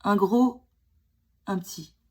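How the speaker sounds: usual speaking voice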